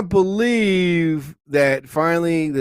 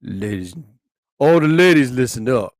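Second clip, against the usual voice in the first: cheesy lover-host persona, lower; smooth radio lover; 5 semitones lower than usual. Acted. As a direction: croon it to the ladies listening. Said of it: "Doctor Daddy Love Show".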